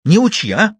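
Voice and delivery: high, loud furious snap. A narrator's reading. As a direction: bite his head off. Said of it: "рассвирепела Маша".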